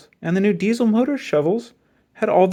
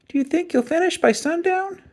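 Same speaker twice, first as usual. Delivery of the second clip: put-on much higher, small child's voice; little boy. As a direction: sound like a curious little boy. Little boy asks Mike.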